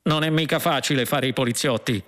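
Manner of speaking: lightly voiced aggrieved policeman; a hint of a complaining cop; policeman's voice. A narrator's reading. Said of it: "una voce rispose".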